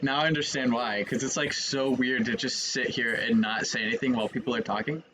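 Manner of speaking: ordinary delivery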